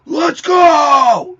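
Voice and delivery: Let's go shouted high and loud; a big pumped-up yell; hype shout. Real, not acted. Hyping up screamo karaoke.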